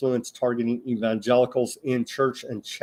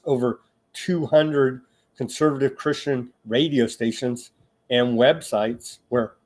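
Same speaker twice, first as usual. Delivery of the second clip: ordinary talk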